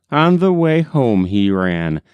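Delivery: plain reading voice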